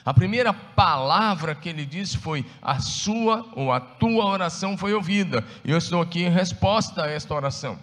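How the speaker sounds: loud, fervent sermon voice; preaching. Acted. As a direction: preach it out with fire. Preaching at full force.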